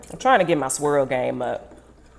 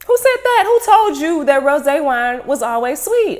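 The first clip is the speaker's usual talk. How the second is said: high, loud mock outrage; hammed-up disbelief at a guest; indignant retort; 10 semitones higher, 5 dB louder than usual. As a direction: play up the shock of hearing it. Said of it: "I said".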